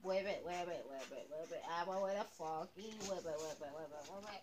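lightly voiced little frog. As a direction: chant it like a hopping frog. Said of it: "I'm trying to sound like a frog".

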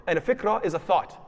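usual speaking voice

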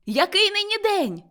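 high, loud call to someone below. A narrator's reading. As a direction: holler the question cheerfully. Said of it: "гукнув Скрудж".

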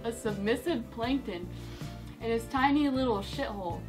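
ordinary delivery

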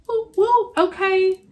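high, bright whoops of celebration; excited cheer. Real, not acted. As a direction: cheer on the thirty-somethings. Cheering for those in their thirties.

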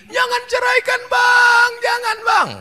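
high, wailing plea; pleading wife. Acted. Abandoned wife begs husband.

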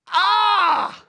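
loud, high, drawn-out groan of pain; demonstrated pained cry; painful groan. Shown as a demonstration. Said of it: "a painful groan".

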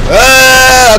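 long, high, loud "uh"; loud cry. Real, not acted. Watcher reacting to the screen, loud high cry. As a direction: let out a drawn-out high holler.

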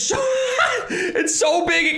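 high giggle then slow amazed words; laughing. Real, not acted. Streamer seeing the giant pet, laughing in disbelief.

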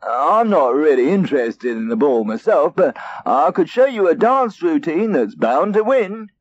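a scheming cat; sly helpful-sounding cat; sly Custard. Acted.

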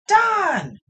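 high, loud call through the door; mom yelling. Acted. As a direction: yell the name like an impatient mom. Mom bangs on door calling.

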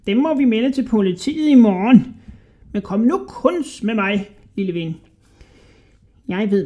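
high, squeaky, wheedling rat voice; sly little rat man. A narrator's reading. Little rat flattering a hungry Hodja, high and squeaky.

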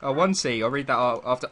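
usual speaking voice

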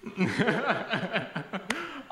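long rolling laugh; real laugh. Real, not acted. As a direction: laugh at your own blunder. Laughs at his own blunder.

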